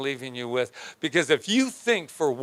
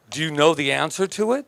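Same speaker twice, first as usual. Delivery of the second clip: ordinary talk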